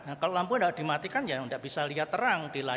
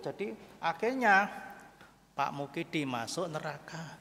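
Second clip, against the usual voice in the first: ordinary talk, nothing marked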